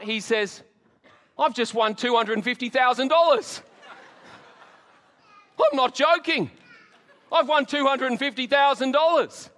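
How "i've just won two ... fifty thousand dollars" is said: higher and louder, stunned and thrilled; excited disbelief. Retold or imitated. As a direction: shout the win like you can't believe it. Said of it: "looks up in shock".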